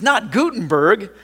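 high, loud and slow, a gasp of dismay; horrified book lover cries out; exclaimed in horror. A narrator's reading. Book lover hears the Bible was thrown away, horrified. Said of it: "the book lover exclaimed in horror".

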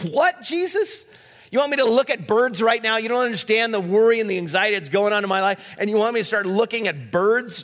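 mock outrage at looking at birds; incredulous listener. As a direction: protest as if it makes no sense. Mock listener objecting to Jesus.

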